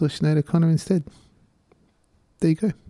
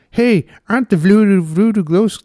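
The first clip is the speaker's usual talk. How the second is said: put-on higher, louder stab at an Irish accent; Irish accent attempt; 7 semitones higher, 6 dB louder than usual. Host retrying an Irish accent, breaking off. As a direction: push the Irish accent then give up. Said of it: "I can't say Voodoo Glow Skulls in an Irish accent".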